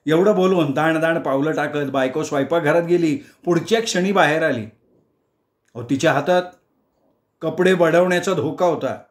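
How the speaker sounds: ordinary delivery, nothing marked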